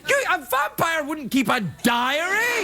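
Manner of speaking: high, shouted disbelief; incredulous rant. Real, not acted. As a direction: push it up into mocking disbelief. Ranting at vampire diaries.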